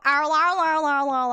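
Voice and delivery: loud, high murloc babble; loud play-acted creature yowling; mock murloc noise. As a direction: imitate a murloc's babbling cry.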